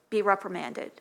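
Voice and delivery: normal talking voice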